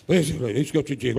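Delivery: low, eerie mock spirit voice; changed demonic voice. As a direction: drop into an eerie put-on voice to mock her. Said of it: "Na hora que mudar a voz é o capeta".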